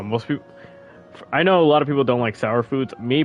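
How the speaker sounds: ordinary talk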